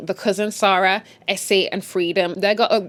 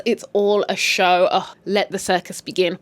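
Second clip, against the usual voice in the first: ordinary delivery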